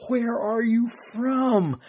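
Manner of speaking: high, drawn-out frightened demand; mildly acted fear and frustration, stretched slowly; frustrated, fearful. Pilate questioning Jesus away from everybody, frustrated and afraid. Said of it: "this is from frustration and fear".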